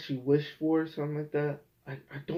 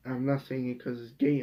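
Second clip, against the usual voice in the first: ordinary talk, nothing marked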